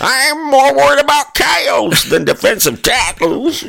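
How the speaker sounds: put-on high, shouting old prospector voice. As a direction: holler it like a wild old miner. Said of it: "that's old Prospector".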